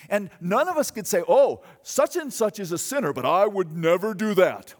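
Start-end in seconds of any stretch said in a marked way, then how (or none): 1.3-4.7 loud, self-righteous protest; smug claim. Pretend. Preacher mocking a smug hearer, loud and self-righteous. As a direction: act the smug churchgoer.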